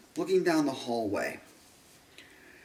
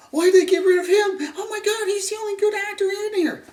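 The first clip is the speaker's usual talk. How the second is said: high, loud exaggerated whine; hammed-up whiny fans; 5 dB louder, faster than usual. Mocking everybody's outrage at his death, high loud whine. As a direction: overplay the outraged fan. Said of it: "this is my, everybody else".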